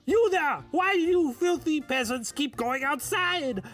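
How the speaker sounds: high, slow, scolding French official; hammy French accent, scolding the peasants; French chef. Acted. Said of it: "sacre bleu".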